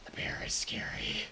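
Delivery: hushed, scared whisper. Real, not acted. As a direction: whisper it like it could hear. Whispered fear.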